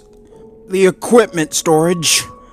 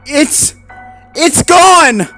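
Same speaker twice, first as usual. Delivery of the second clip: much higher, louder stammered cry; panicked; 7 dB louder, much slower than usual. Acted. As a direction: blurt out in horror. Panicked discovery.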